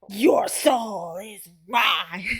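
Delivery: slow villain impression. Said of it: "You gotta go like".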